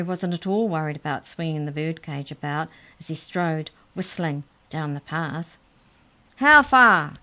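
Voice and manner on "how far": big, high, loud booming demand. A narrator's reading. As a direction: boom it at the bird in the cage. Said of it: "he boomed".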